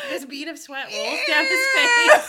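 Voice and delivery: laughing outburst at the gross memory; laughing shout. Real, not acted. Gross sweat story climax.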